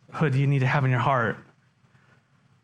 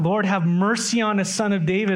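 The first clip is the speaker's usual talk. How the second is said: crying out; 7 semitones higher than usual. Pretend. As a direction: call out for mercy from the roadside. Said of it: "all you can do is just cry out".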